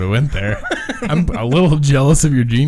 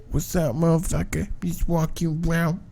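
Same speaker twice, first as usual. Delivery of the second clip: high, soft trashy dude greeting; coworker impression; 4 semitones higher, 6 dB quieter than usual. Retrying how the work guy talks, high soft mimicry. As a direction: put on the coworker's voice, pitched up and quiet. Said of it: "He's like".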